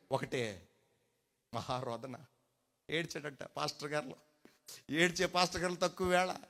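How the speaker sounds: ordinary talk, nothing marked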